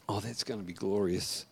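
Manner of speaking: very soft, low murmur to oneself; hushed aside. Real, not acted. Preacher reacts to the prophecy he reads, hushed aside. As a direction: drop right down in quiet awe.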